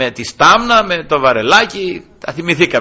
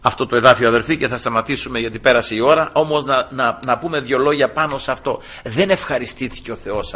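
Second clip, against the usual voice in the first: ordinary talk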